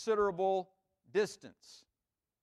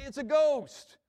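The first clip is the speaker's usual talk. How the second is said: frightened cry; 7 semitones higher, 6 dB louder, slower than usual. Pretend. Frightened disciples in the boat.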